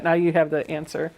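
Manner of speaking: usual speaking voice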